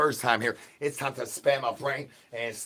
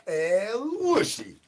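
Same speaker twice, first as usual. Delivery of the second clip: crooning call in a strange voice; high, slow put-on voice; 9 semitones higher, much slower than usual. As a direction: stretch El Wushi out slowly and high. Repeated character chant.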